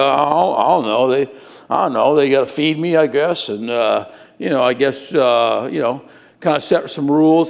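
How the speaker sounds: put-on low, halting teen mumble; clueless teenager. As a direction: imitate a teenager with no idea, hesitating. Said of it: "When I ask teenagers".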